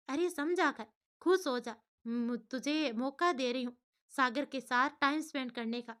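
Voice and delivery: usual speaking voice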